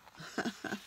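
short unvoiced laugh; a little self-mocking laugh; breathy laugh. Real, not acted. Laughs at own mess.

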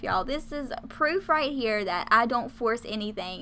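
ordinary delivery

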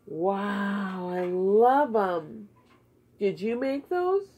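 normal talking voice

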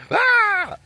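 high shrieked "Ah!" imitation; mock girly scream. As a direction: imitate a little girl's scream. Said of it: "He screamed like a little girl".

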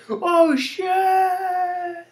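high squeaky oh shit; hammed-up startled falsetto; mock panicked yelp. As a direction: shriek it as he flies off. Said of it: "he goes off to the side over here saying".

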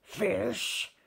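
grumbling lobster. Acted. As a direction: scoff it out grumpily. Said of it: "the lobster grumbled".

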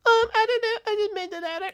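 tiny, very high falsetto; exaggerated squeaky mimicry of a friend; Bushy. Player answering as his friend about the ladder, squeaky falsetto. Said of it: "That's not how Bushy talks".

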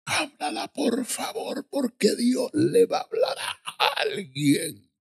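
high, stretched preacher plea; imitated preacher. Preacher urging a rebellious village to listen, high imitated plea. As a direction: plead it high and drawn out like a preacher. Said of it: "Entonces dice".

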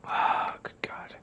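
breathless whispered gasp; whispering. Real, not acted. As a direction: whisper it in disbelief. Shocked at the game.

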